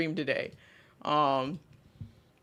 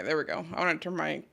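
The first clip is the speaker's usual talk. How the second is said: usual speaking voice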